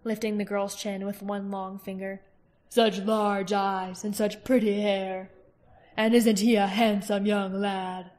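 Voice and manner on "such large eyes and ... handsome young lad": slow, cold, mocking royal menace; king Galbatorix, silky and menacing. A narrator's reading. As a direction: purr the praise of the children like a threat. Narrator voices the king.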